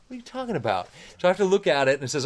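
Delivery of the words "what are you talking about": high-pitched retold bewilderment; baffled. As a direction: ask it in high, baffled disbelief. Retold reaction to unknown band credit.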